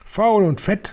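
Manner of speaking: high jeering yell of a witch crowd; crowd shouting. Acted. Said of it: "schrie das Auditorium".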